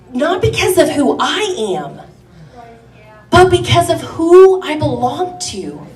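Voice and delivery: rising, fervent sermon voice; preaching. Acted. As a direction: preach it with conviction. Preaching rises again.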